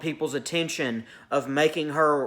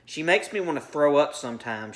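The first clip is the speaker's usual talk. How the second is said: usual speaking voice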